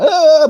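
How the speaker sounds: loud, high drawn-out yell; hammed-up excitable TV pundit cry; Chris Kamara. Guest imitating how he expected Kamara to be on telly, mock yell. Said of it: "like he's on the telly".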